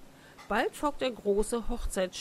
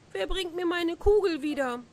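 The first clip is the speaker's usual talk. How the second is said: higher, lightly lamenting plea; mildly tearful wail; 8 semitones higher than usual. A narrator's reading. Princess begging for her lost ball, mildly tearful. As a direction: plead in a raised voice, a touch distressed. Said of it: "Wie weinte sie sogar entsetzlich".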